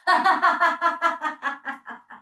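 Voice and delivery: loud, high belly laugh after the cheer; hearty laugh. Shown as a demonstration. Laughter teacher laughing out loud after pouring. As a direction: laugh out loud as you drink it up. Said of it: "and drink it up".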